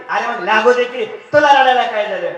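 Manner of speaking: loud, high and dismissive; dismissive old man. Acted. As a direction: shout that it doesn't matter. (बेफिकीरपणे ओरडणारा म्हातारा)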